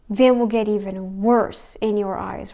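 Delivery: ordinary delivery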